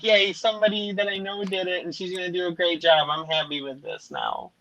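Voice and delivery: high-pitched happy cheer; retold delight. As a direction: act out relieved glee, easing off at the end. Retells own delighted relief.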